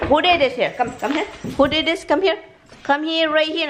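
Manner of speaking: high, insistent summons; scolding pets. Real, not acted. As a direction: call the culprits over in a high scolding voice.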